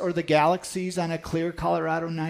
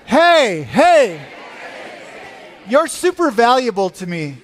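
raised voice, calling out love; loud affectionate call; 4 semitones higher, 6 dB louder than usual. Shown as a demonstration. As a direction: call it out so they really hear it. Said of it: "and say".

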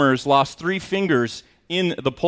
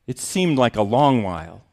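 ordinary talk, nothing marked

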